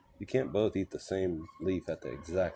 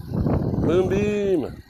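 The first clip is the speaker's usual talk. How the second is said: excited greeting; 11 semitones higher, 7 dB louder than usual. Real, not acted. Greeting stream viewer.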